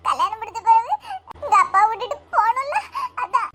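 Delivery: halting, tearful explanation; crying. Acted. Bride telling her friend she must leave father, crying. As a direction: explain between sobs, in broken phrases. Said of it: "ஏன்டி இப்படி அழியா?".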